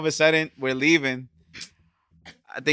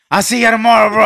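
shout; 7 semitones higher, 10 dB louder, faster than usual. Retold or imitated. Drunk friend shouting goodbye.

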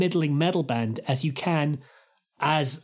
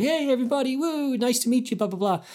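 cheery greeting; 7 semitones higher than usual. Retold or imitated.

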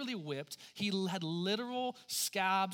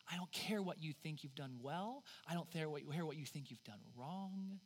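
softer, lower voice of God, fading to a whisper; God speaking softly; 3 semitones lower, 10 dB quieter than usual. Acted. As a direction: speak gently, then let it drop to a whisper. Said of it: "And God says".

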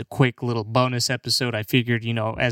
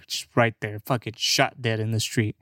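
ordinary talk

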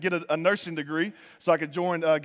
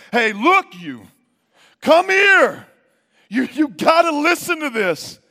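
high, emphatic shout; volume turned up; 8 semitones higher, 8 dB louder, slower than usual. Acted. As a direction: call out loudly to grab everyone's attention. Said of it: "he's turning the volume up in his message".

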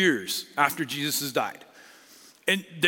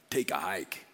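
mock dismissal; 10 semitones lower, 7 dB quieter than usual. Church rejects Saul.

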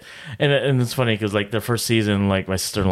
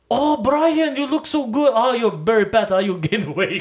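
much higher, gushing then teasing; Filipino auntie; 9 semitones higher than usual. Retold or imitated. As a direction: greet him sweetly, then jab about his weight. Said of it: "it's just like".

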